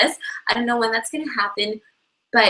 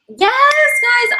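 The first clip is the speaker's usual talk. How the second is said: high loud thrilled yes; excited yes; 12 semitones higher, 6 dB louder than usual. Real, not acted. Answering Europe meetup question.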